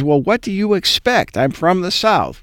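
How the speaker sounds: high, mock Southern lady voice; Lou's Southern accent. Host quoting the guest's retort, in a high mock Southern accent. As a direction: play back her reply in a lightly hammed-up Southern lilt. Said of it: "And she says".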